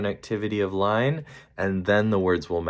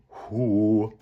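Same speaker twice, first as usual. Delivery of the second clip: owl hoot. Shown as a demonstration. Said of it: "hoot like an owl".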